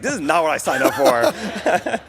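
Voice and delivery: slow, laughing mock complaint; retelling a friend's laughing protest, slow and amused. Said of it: "Gabe's like".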